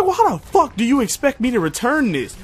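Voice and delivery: high-pitched, incredulous comeback; exasperated retort. Retold or imitated. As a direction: re-enact losing patience with a customer. Acted exasperated reply to customer.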